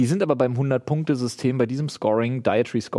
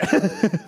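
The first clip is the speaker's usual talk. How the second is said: high burst of laughter. Real, not acted. Laughing at the punchline.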